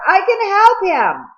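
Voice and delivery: high, loud and slow pleading cry; desperate scream. Acted. Cole shouting across the water to Edwin. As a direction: yell it after the boat, stretched out. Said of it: "Cole screamed across the water".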